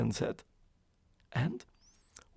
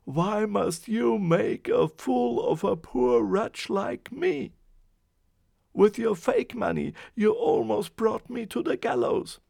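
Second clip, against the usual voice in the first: complaining; 7 semitones higher, 7 dB louder than usual. A narrator's reading. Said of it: "the man complained".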